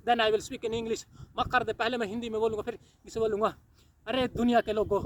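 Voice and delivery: usual speaking voice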